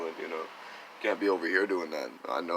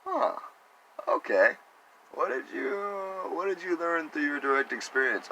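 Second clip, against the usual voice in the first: skeptical questioning; 10 semitones higher than usual. Retold or imitated. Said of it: "It's like".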